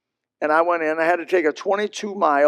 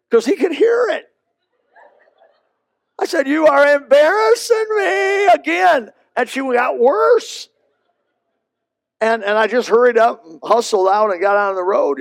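laughing while telling; 9 semitones higher, 5 dB louder than usual. Real, not acted. Teller laughing recounting.

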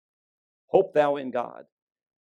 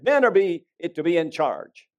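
loud, raised-pitch rallying call; commanding seminar speaker; 5 semitones higher, 9 dB louder than usual. Retold or imitated. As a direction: fire up the men like a seminar speaker. Seminar speaker exhorting men, retold.

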